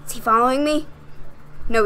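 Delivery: ordinary delivery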